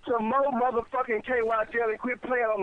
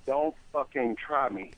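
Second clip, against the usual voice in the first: low threat; 7 semitones lower, 3 dB quieter, slower than usual. Real, not acted. Husband repeating threat slowly.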